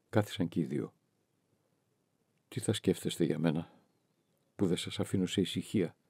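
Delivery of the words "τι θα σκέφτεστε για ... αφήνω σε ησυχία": soft, faltering murmur; stammered softly. A narrator's reading. Victoria softly stammers to Johannes on the bench, soft faltering voice. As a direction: say it softly, almost apologising. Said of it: "ψέλισε η Βικτόρια".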